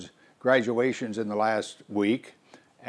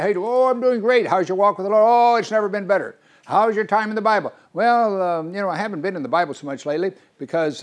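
higher, louder chirpy small talk; breezy complacency; 7 semitones higher, 4 dB louder than usual. Pretend. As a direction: play the carefree believer brushing off the question. Acted dialogue with a complacent believer.

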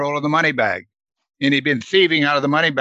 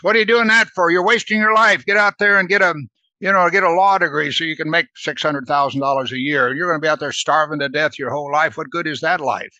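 higher, louder scolding relative, mocking a wasted life; scolding unbelieving family; 4 semitones higher, 3 dB louder than usual. Family scolding a missionary: louder, higher scoffing. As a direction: nag like a family scoffing at ministry. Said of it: "And there's all this resistance".

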